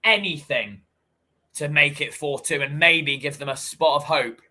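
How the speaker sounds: lively football commentary, pushing on the chances; commentating the final minutes for the viewers, eager play-by-play. Acted. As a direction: call the match like a TV commentator. Does own live match commentary.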